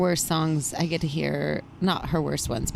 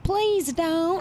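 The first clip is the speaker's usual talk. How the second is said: sweet plea; 11 semitones higher than usual. Pretend. Imitating Sarah's sweet plea.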